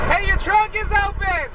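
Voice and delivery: high called-out warning; calling out. Real, not acted. Warning a stranger that their trunk is open. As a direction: shout across the lot.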